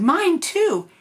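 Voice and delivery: high, loud mama bear; mother bear voice. Acted. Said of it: "the mommy bear said".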